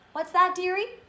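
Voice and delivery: put-on high, loud question with a light Polish accent; Missus Zalewski. A narrator's reading. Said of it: "in her thick Polish accent".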